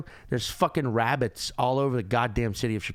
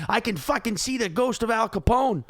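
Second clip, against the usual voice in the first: Chicago drinker; 7 semitones higher, 3 dB louder than usual. Retold or imitated. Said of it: "they're like".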